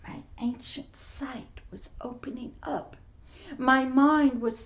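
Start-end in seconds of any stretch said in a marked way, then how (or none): 0.1-3.0 breathy hushed testimony; hushed whisper. Real, not acted. Preacher whispering his testimony to the congregation. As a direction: whisper it like a sacred secret.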